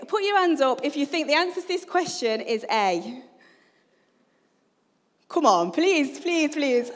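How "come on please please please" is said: raised, louder mock begging; playfully imploring; playful pleading. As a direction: plead with the audience for a show of hands. Begging congregation to raise their hands.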